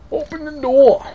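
very high, loud squeal; high-pitched yell. Real, not acted. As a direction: squeal it out at the top of your range. Startled or impatient player calls out in game.

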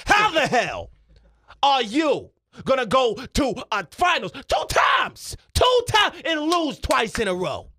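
high, indignant pundit outburst; Stephen A Smith. Acted. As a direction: hammer the question in disbelief. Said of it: "Stephen A Smith".

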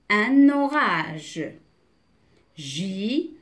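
slow, deliberate word-by-word reading; measured reading with pauses between items; teacher's word-list reading. Shown as a demonstration. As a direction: model each word slowly for beginning readers. Reads word list to learners.

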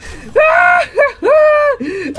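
very high squealed cackle; excited shrieking laugh. Real, not acted. Overexcited urge to touch game figure.

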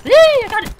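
shrieking with joy; triumphant yell. Real, not acted. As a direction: cheer it out loud and high. Gets the kill.

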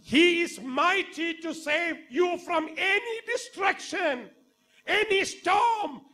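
very high, loud, slow shout; preaching shout. Acted. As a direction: cry it out over the congregation.

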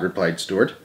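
plain reading voice

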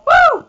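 shrill victory whoop; high whooping cheer. Real, not acted. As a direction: whoop at the top of your voice. Celebrating the champion.

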